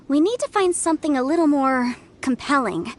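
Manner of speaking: normal talking voice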